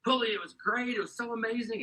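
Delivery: high, eager gush over the retreat; excitable friend. Retold or imitated. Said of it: "Daryl's a little excitable anyway".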